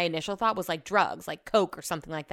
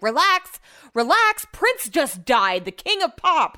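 mocking boss; 6 semitones higher, 6 dB louder than usual. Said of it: "And he was like".

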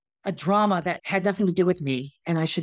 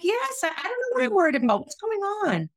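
very high-pitched, distressed pleading; imitating herself frantic for an answer; retold panicked worry; 12 semitones higher than usual. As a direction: re-enact anxious confusion, pitched way up. Upset reply on being threatened with suspension.

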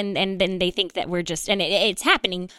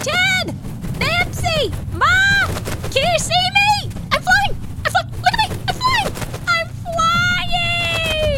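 shrieking with joy, very high; ecstatic high shout; slower than usual. Acted. Ostrich flying, calling to family.

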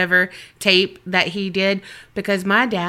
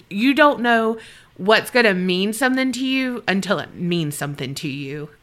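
ordinary talk

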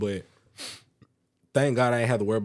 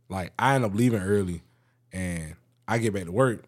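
usual speaking voice

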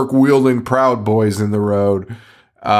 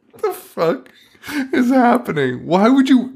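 high, squeezed voice after a laugh; real incredulous high-pitched exasperation; laughing disbelief; 12 semitones higher than usual. Real, not acted. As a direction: exclaim it helplessly, still cracking up.

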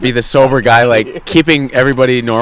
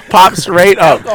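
slow, higher words through laughter; laughing; 9 semitones higher, much slower than usual. Real, not acted. Telling friends the drunk woman recovers, laughing. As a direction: repeat it amazed, cracking up.

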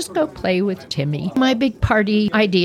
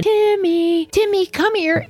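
calling the dog; 12 semitones higher than usual. Retold or imitated. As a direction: call the little dog out to you.

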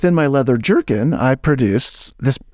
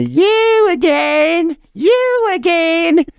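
screaming landlady; much slower than usual. Acted. Landlady screams at the salesman on her porch, slow high shriek. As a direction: shriek it at the door like a furious old woman. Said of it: "she screams at me".

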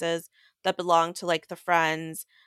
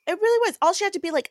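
emphatic; 13 semitones higher, 5 dB louder, much faster than usual. Real, not acted. Emphatic agreement, venting.

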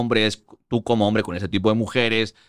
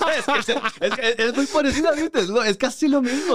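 words squeezed out through laughter, much higher; high, laughing through the explanation; laughing while talking; 13 semitones higher than usual. Real, not acted. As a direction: talk while still cracking up. Still laughing after punchline.

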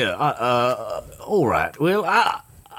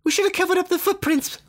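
put-on high squeaky little boy; high kid voice; faster than usual.

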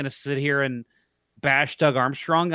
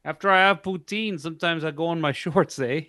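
put-on a Canadian accent, higher, ending on eh; mock Canadian accent; 3 semitones higher than usual. Poutine joke told in a mock Canadian accent. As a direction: play a hoser with a poutine joke. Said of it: "with that accent".

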